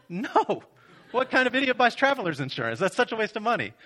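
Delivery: high, scoffing outburst; mock indignant. Groom answering the insurance question, hammed-up indignation. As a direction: scoff in exaggerated disbelief at the very idea.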